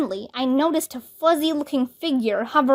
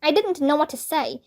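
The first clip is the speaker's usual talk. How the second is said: ordinary delivery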